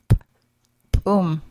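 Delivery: "boom" with a popped glottal p. Shown as a demonstration. Teacher modelling glottal P for learners, popped p. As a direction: pop the "p" hard. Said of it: "Glottal P pronounced as a popped P".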